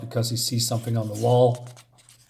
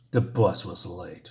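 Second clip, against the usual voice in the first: slow, lower mocking repeat of the excuse; mocking echo; 7 semitones lower, 3 dB quieter than usual. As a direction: throw his words back at him, slowly and lower. Bully mocks Scott's excuse.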